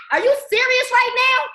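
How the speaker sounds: high, loud excited disbelief; mock excited disbelief. Teasing friends who eat while she dances, a loud excited disbelief. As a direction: exclaim it as if you can't believe it. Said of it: "I'm gonna be like".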